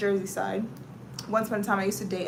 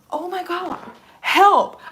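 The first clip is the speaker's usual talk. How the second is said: exasperated cry for help; 8 semitones higher, 8 dB louder, slower than usual. Retold or imitated. Quoting herself in hot subway.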